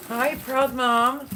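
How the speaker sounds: high, loud, drawn-out hello; excited greeting. Real, not acted. Vlogger greets a viewer just arriving, excited greeting.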